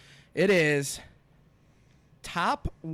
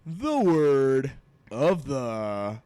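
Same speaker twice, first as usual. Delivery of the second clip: announcer voice; 3 dB louder, much slower than usual. Acted. Drawn-out word of the day announcement.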